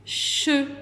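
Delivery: "che" alone with a long ch; drawn-out shhh opening into "che"; isolated syllable. Shown as a demonstration. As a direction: sound out "che" as one separate syllable. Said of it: "par la syllabe".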